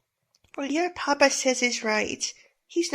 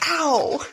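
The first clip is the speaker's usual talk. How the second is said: pained yelp; 3 semitones higher, 4 dB louder than usual. Acted. Hit by a thrown stone.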